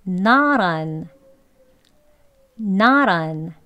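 Not on with T like ㄹ; flap T demo. Shown as a demonstration. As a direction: model Not on for repeating. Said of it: "같이 두 번 해보겠습니다". (따라 하기용 not on)

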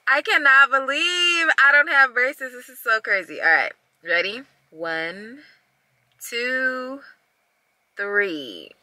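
muffled behind hand; speaking through hand. Real, not acted. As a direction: palm covering mouth. Seen 1: Hand cupped over mouth all through, until she lowers it near 16s.

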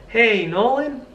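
bright friendly hello; smiling. Shown as a demonstration. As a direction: smile as you say it. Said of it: "Just smile".

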